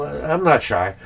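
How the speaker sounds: normal talking voice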